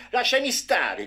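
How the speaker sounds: high, loud refusal; boy shouting at his father to leave him alone; defiant shout. A narrator's reading. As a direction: yell at your father to let go.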